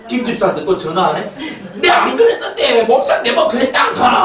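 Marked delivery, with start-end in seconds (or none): 1.8-4.2 loud, high mock protest; hammed-up aggrieved yelling; indignant shout. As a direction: shout the grievance down the phone. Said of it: "하면서 막".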